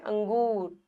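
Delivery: usual speaking voice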